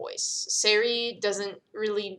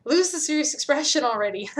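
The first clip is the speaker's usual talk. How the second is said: higher, louder teasing with a laugh; 6 semitones higher, 4 dB louder than usual. Acted. Karenina teases the serious Serafina, laughing. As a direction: tease her out of the gloom.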